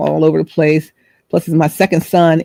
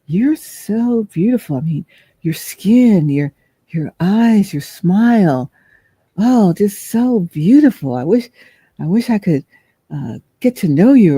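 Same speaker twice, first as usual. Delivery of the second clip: lightly voiced admirer; 4 semitones higher, slower than usual. Grocery man flirting.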